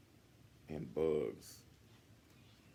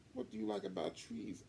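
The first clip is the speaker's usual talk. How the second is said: put-on a girl asking her partner kindly; curious Sophie; 13 semitones higher than usual. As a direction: gently ask Biff.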